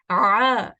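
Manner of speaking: ah squeezed from the middle of the throat, loud; constricted ah from mid throat; Arabic ain sound shown to students; throat ain demo. Shown as a demonstration. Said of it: "put a hand to your throat".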